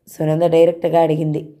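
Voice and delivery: plain reading voice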